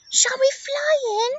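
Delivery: high, swooping little bird voice; chirpy parrot. Acted. Parrot answering how she'll cross, high and chirpy. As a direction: pipe it up like a storybook parrot.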